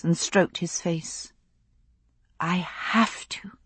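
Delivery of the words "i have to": breathy, hushed whisper; soft whisper. A narrator's reading. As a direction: whisper it gently in the dark. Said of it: "she repeated softly".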